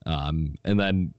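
usual speaking voice

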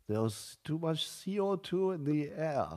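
hammed-up Swiss or Austrian official voice; Swiss or Austrian accent. Mocking the forum's climate warning, in a hammed-up Swiss-Austrian accent. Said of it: "I'm trying to do a Swiss accent or Austrian".

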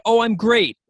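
high, loud mock enthusiasm; exaggerated polite delight; fake cheery. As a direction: put on the perky answer everybody expects. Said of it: "everybody wants you to say".

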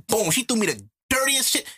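ordinary talk

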